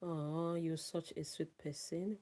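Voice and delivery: normal talking voice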